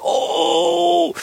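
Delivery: put-on very high child's gasp of wonder; a little kid going oh in awe; awed kid. Kid stunned by pizza rooms.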